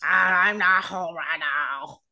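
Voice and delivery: low, slow croaking frog; drawn-out guttural frog voice; throaty frog voice. Acted. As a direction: leave the greeting as a slow, deep frog. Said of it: "lowering your voice into your throat".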